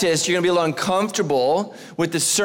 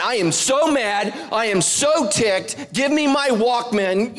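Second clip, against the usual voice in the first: slow, higher mock fury; mock angry guy; 6 semitones higher, slower than usual. Pastor mocking a frustrated man who wants to dance, slow and hammed-up. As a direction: ham up a mad guy. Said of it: "You have never, ever thought".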